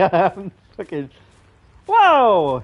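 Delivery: normal talking voice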